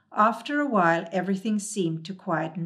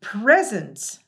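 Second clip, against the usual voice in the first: louder burst on Presents!; exclaimed; 3 semitones higher, 8 dB louder than usual. A narrator's reading. Hemulan to the Moomins, a loud exasperated exclamation. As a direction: blurt it out in dismay. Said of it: "the Hemulan exclaimed".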